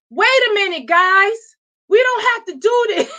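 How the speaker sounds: high, strained plea that tips into a crying shout; panicked pleading. Retold or imitated. Mother pleading with gang members outside the car, high frantic plea. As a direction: beg them to calm down, voice cracking upward. Scared mom backing down from gangs.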